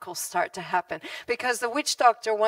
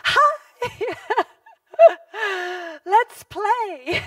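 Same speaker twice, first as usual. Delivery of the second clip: put-on little girl voice; 9 semitones higher, 7 dB louder, much slower than usual. Little girl entering village.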